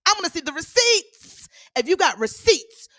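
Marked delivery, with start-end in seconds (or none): 0.0-1.5 playful diva belt, higher and louder. Retold or imitated. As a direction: belt it like a pop diva demanding proof. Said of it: "I'm like [name]".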